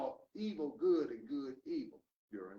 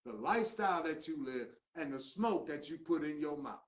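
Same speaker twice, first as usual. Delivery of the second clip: usual speaking voice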